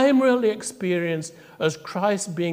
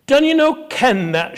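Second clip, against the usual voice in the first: indignant retort; 9 semitones higher, 6 dB louder than usual. Retold or imitated. Old woman retorts to minister.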